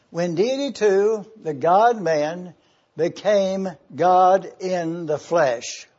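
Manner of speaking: slow, deliberate preaching, word by word; slow it right down so the congregation can follow; measured slow delivery for note-taking; going slowly. Real, not acted. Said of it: "I'm going slowly so you can follow".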